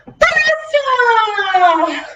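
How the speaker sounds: high, loud cry of blast off; excited blast off. Acted. Teacher shouting the liftoff for kids. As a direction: launch the rocket with a shout.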